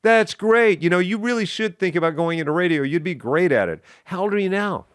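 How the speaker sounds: a hint of an eager radio pro; radio guy. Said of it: "He said".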